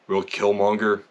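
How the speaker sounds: ordinary talk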